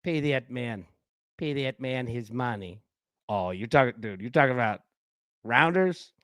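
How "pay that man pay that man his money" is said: low, quiet movie-villain impression; John Malkovich. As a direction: drop it low and soft, accented. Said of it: "Best John Malkovich line".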